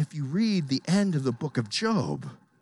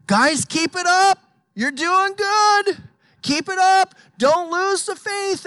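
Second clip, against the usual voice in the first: much higher and louder, hollow cheer; mock cheerful Paul; 14 semitones higher, 7 dB louder than usual. Preacher mocking a comfortable Paul cheering on sufferers. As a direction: ham up an easy encouragement from the comfy seat. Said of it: "he keeps on saying".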